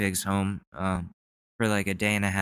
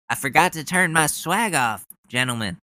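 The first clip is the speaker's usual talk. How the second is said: mock Ted Cruz; 6 semitones higher, 3 dB louder than usual. Continues mocking Cruz.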